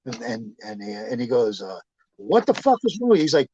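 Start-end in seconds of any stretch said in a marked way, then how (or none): 2.2-3.2 high-pitched exasperated challenge; retold angry demand. Man arguing with a woman, indignant. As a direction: mimic him snapping back at her. Retold fight between the two.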